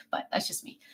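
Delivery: breathy whisper, no voice at all; hushed confession to the viewers; whispered aside. Real, not acted. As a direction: let it slip out as a secret. Aside after confessing dislike.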